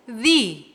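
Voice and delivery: "the" with a long ee vowel; "the" as thee. Shown as a demonstration. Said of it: "tem som de vogal".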